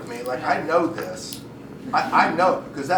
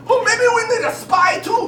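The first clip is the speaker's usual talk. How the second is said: put-on very high, loud German accent; hammed-up German tribesman; German tribe member; 7 dB louder than usual. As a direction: pipe up with a squeaky German accent. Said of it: "it was German tribes they were talking about".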